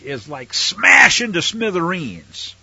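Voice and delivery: loud, slow smash into smithereens; emphatic. Shown as a demonstration. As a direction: punch smash into smithereens hard. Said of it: "it's more emphatic".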